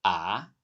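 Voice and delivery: "ah" starting low and rising steeply; "ah" with a questioning rise; second tone. Shown as a demonstration. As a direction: swing "ah" upward like asking what. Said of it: "let's sing ah with the four tones".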